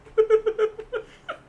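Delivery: bright burst of laughter; laughing along; high laugh. Real, not acted. Laughing at the joke.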